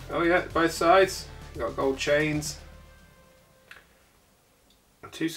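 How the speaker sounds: ordinary talk, nothing marked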